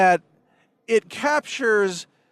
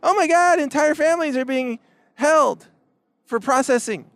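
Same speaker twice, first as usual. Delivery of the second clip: mock shriek; 7 semitones higher than usual. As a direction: parody of a shocked protester. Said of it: "starts screaming a whole different thing".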